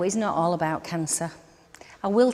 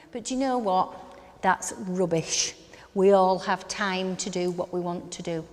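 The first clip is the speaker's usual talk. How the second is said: usual speaking voice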